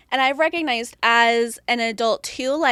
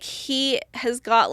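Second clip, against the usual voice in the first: ordinary delivery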